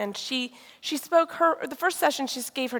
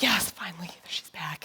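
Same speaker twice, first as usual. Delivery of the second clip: hushed, whispered relief to oneself; relieved whisper; 5 semitones lower, 4 dB quieter than usual. Retold or imitated. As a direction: whisper it low, trailing down softer. Acted inner relief as session resumes.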